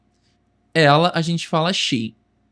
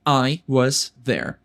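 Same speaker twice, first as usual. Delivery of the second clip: I was there bem lento e claro; devagar; 4 semitones lower, much slower than usual. Shown as a demonstration. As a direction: articule cada palavra para o aluno. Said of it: "Como eu digo, eu estava lá". (I was there, lento, para repetir)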